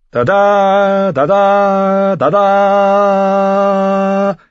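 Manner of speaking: high, loud brassy trumpet call on each da; trumpet blast. A narrator's reading. Lannister trumpets answering the northern war horn, metallic and defiant. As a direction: sing out the da-das like a challenging fanfare. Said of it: "Die Trompeten der Lannisters antworteten".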